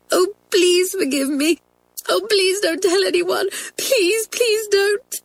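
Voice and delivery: high-pitched tearful pleading; sobbing. Acted. Girl behind a door begs forgiveness, sobbing. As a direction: beg desperately in a high, crying voice. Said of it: "Someone was sobbing and crying inside".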